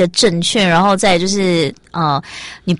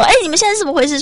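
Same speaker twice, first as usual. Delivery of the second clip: mock-stern demand, pitched much higher; high-pitched scolding, retold; retold fierce questioning; 10 semitones higher, much faster than usual. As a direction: imitate someone sharply confronting a person. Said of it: "很凶的问他说".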